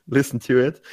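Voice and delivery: ordinary talk, nothing marked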